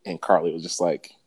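usual speaking voice

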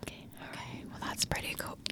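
soft whispered welcome; ASMR whisper. Pretend. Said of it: "let's do some A S M R".

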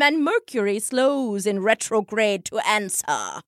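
grand carnival seer voice; mystic soothsayer. Acted. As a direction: proclaim it like a stage psychic.

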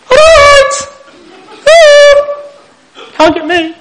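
high, loud cheeky taunt; cocky wildebeest. Acted. Preacher playing a stray wildebeest to the congregation, cocky taunt. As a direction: whoop it out as the mock wildebeest running off.

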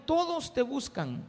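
slow, high, loud call. Retold or imitated. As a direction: call out to him across the crowd. Disciples calling out to Jesus.

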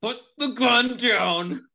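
slow, high-pitched order; acted forceful, stretched demand; standoff command. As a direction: give a drawn-out order to a gunman. Player orders man in standoff.

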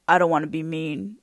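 low, softened man's voice; lightly voiced bouncer. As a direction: take someone aside with a low word. Bouncer pulls her in, explains.

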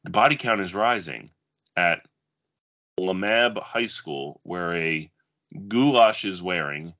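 dramatic blurb reading, measured pace; movie blurb read. Acted. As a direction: read it like a video box cover. Reads another movie blurb.

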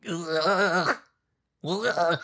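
throaty retching ugh; disgusted groan. Real, not acted. Fan recalling Natasha's death, disgusted groan. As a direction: groan as if sickened.

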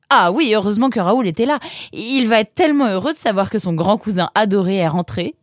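put-on high, quick, eager girl's voice; lightly voiced excited sister; enthusiastic sister. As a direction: chatter happily about the homecoming.

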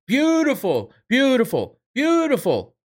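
high, loud mock praise; hammed-up beautiful chant; mocking Conor cornering. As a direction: gush it like a cornerman on repeat. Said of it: "Every fucking thing his fighter did".

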